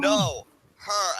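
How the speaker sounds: loud, high mock-panicked objection; imitating frantic correction. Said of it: "is just so terrified".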